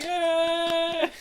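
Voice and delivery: high, loud goblin cheer. Acted. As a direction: throw up a crowd cheer. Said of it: "everyone's cheering".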